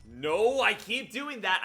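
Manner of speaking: loud, high, drawn-out groan of frustration at himself; frustrated outburst. Real, not acted. Streamer scolding himself after another failed jump, loud frustrated outburst. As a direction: blurt it out, fed up with his own mistake. Botched game move, self-reproach.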